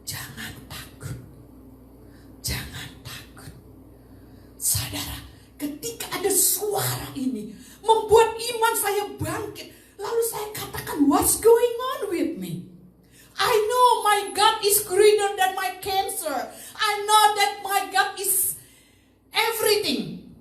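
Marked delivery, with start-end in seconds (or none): 0.0-3.5 soft, voiceless reassurance; hushed divine whisper. Retold or imitated. God speaking to a frightened patient, hushed whisper. God's voice heard in stillness, retold. 7.8-20.3 high, loud declaration of faith; full-force preaching. Acted. Preacher declaring God greater than cancer, full-force preaching.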